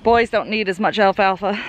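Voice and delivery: normal talking voice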